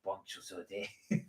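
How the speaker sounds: low, soft, slow mock French; hushed French-accented greeting, drawn out. Shown as a demonstration. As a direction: linger quietly in a put-on French accent. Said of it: "put on a French accent".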